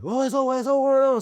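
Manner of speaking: high, hammed-up chant of it's over; mock panicked yell. Masked men at the car, retold as a high mock panicked yell. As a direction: squeal it like the guys jumping out the car.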